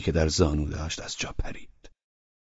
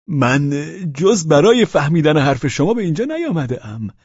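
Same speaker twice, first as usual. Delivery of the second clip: put-on flustered drunk; 9 semitones higher, 6 dB louder than usual. A narrator's reading. Pavel flustered, denies being drunk to Velchaninov. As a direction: lean on the word نه, dragging it.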